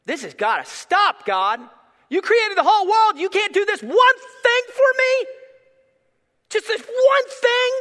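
crying, mad prayer. Retold or imitated. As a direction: break into a tearful yell of despair. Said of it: "I'm crying, I'm mad".

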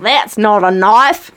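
put-on slow, drawn-out Australian accent. Trying an Australian accent for friends, drawn-out Australian. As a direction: stretch the vowels in broad Australian. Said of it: "an Australian accent".

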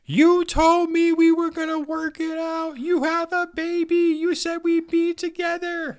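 high, slow shrill mock wail of a betrayed wife; yelling wife. Wife yelling down at her cheating husband, hammed-up wail. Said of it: "She's yelling from the top".

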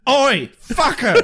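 slow, rough "oi"; brash London geezer; Cockney accent. Pretend. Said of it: "or better yet".

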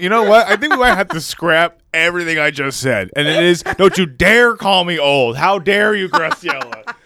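hammed-up how-dare-you; mock outrage. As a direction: act offended. Mock outrage at being called old.

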